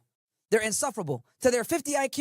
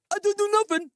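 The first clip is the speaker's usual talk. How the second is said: higher, louder mimicked denial; mock protesting suspect; 6 semitones higher, 3 dB louder than usual. Streamer mocking suspects caught on body cams, mock protest. Mocks suspects denying their crimes.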